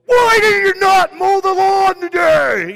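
explosive yell out of nowhere; sudden angry shout. Retold or imitated. Dad suddenly yelling at son over the lawn, furious shout. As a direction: bellow it like a dad blowing up at dinner. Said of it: "all of a sudden he'd go".

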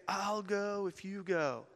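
slow, high, soft platform voice; altar-call speaker. Retold or imitated. Preacher telling crowd what to tell neighbors, slow gentle high voice. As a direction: say it slowly and softly, as a coaxing preacher.

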